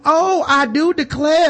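hammed-up Southern belle on camera; mock Southern hostess turning on the charm; Southern belle accent. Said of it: "the cameras are back on".

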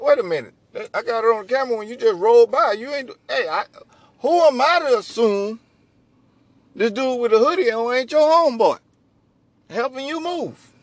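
high, loud indignant rant; agitated complaint. Retold or imitated. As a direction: call them out, worked up. Voicing victim confronting police.

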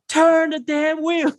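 high slow shout; desperate yell. Retold or imitated. Big brother yelling at kid heading for mailbox, desperate high shout. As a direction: stretch it out in panic.

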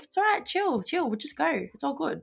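soft, hushed calming of a friend; quiet calming voice to a tense friend; soothing. Retold or imitated. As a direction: settle him down gently.

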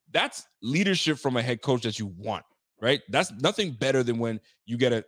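ordinary delivery

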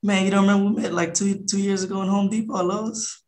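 high, easy, familiar reassurance; buddy-buddy phone pitch. Retold or imitated. Investor reassuring a puzzled seller on the phone, high and familiar. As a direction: act like you've known them for years. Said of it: "I get on the phone like I've been knowing 'em".

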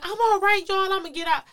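brave reassurance. Retold or imitated. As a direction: act out bravely reassuring your crying family. Calling reassurance to crying family.

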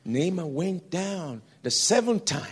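preaching climax. Real, not acted. Preacher crying out about Naaman's seventh time. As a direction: shout it out like the peak of a sermon.

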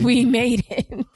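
slow, loud, high cheer; excited cheer. Real, not acted. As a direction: stretch out a happy cheer. Celebrating their anniversary.

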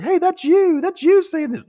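very high, loud imitation; exaggerated high-pitched call-out; mock accusing shout. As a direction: pipe up in a squeaky accusation. Acting out accusing friends in skit.

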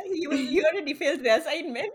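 laughing while teasing; fast, giggling through the words. Real, not acted. Teasing friend who cheated.

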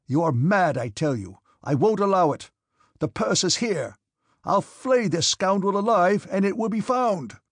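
high, loud, heated outburst; angry shouting. A narrator's reading. Officer refuses to believe a comrade is accused, angry shouting. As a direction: shout them down, insisting furiously.

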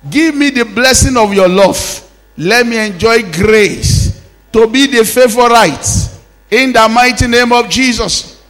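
ringing prayer declarations; fervent prayer. Acted. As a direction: pray it out loud over the congregation. Preaches prayer at full force.

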